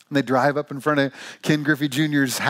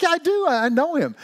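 squeaky high protest; high insisting; 12 semitones higher than usual. Retold or imitated. Dave insisting to his doubting buddy, high protest. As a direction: insist way up high, a bit defensive.

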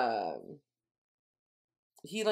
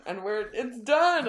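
higher and louder, drawn out slowly in triumph; cheering that the read-through is over; excited announcement; 7 semitones higher, 5 dB louder, slower than usual. Real, not acted. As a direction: celebrate finishing the book. Finishing the book together.

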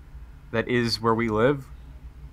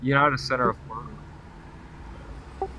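ordinary talk, nothing marked

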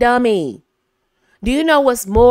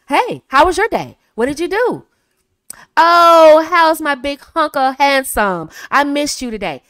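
bright, higher and louder greeting; upbeat and friendly; 5 semitones higher, 6 dB louder than usual. Shown as a demonstration. Wife greeting husband home, upbeat and friendly. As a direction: greet him like you're thrilled. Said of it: "optimistic and positive attitude, upbeat".

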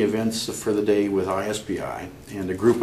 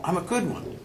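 higher, playful self-praise without humility; mock immodesty; 7 semitones higher than usual. Real, not acted. Practitioner crediting mentors, mock immodesty. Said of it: "he said with, without any humility".

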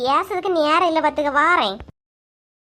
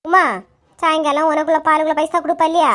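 normal talking voice

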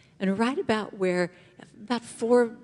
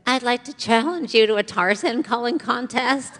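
put-on higher, little-kid voice; thirteen-year-old self; 4 semitones higher than usual. Thirteen-year-old asking her idol for a contest, young kid voice. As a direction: pipe up like a young teen. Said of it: "in my little thirteen-year-old self".

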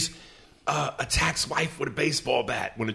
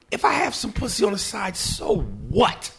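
brazen outburst; 13 semitones higher than usual. Retold or imitated. Husband brazenly telling off his wife, high indignant rant. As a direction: blow up brazenly, pitch shooting way up. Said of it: "I just went flat out".

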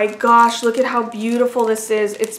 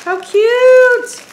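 very high, loud squeal of delight; high, loud gushing "how cute"; 14 semitones higher, 8 dB louder than usual. Real, not acted. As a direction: squeal it like you just opened a gift. Delighted while unboxing a gift.

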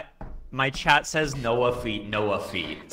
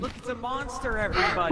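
excited; 8 semitones higher, faster than usual. Real, not acted. Announcing monster to viewers.

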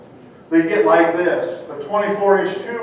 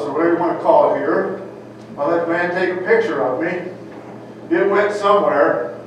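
ordinary delivery

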